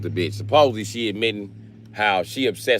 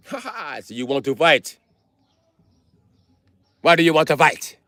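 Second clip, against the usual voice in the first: mock African accent; 8 semitones higher than usual. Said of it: "If Africans say".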